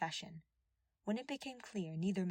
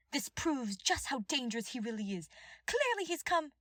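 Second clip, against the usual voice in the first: higher voice, rising alarm; accusing; 7 semitones higher, 5 dB louder than usual. A narrator's reading. Cornelia denouncing Ash to the king, urgent.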